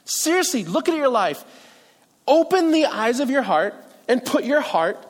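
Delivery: heartfelt, insistent exhortation; emotional pleading. Acted. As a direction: plead personally, pitch lifting on each appeal. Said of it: "a pleading ... an emotional type of".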